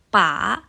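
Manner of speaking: rising "Pa"; "Pa" lifted like a question; second tone. Shown as a demonstration. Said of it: "nada dua".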